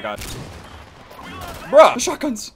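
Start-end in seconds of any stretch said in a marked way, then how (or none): 1.7-2.5 excited shout. Real, not acted. Excited about new shotguns.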